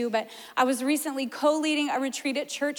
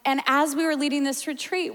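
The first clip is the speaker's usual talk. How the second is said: ordinary delivery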